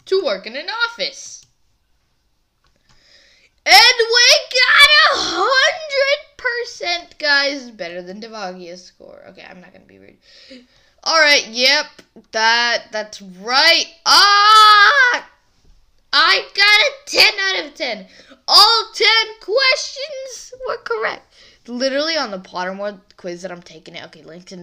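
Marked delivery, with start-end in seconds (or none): 3.7-7.2 high, loud, slow triumphant cheer; squealing victory shout; excited. Real, not acted. Celebrating a perfect quiz score. 14.1-21.3 high, loud squeal of victory; giddy, slowed triumphant cry; excited. Real, not acted. Celebrating ten out of ten.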